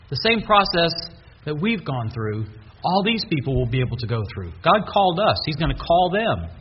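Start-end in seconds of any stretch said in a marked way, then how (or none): none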